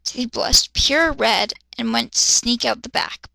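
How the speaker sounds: ordinary talk, nothing marked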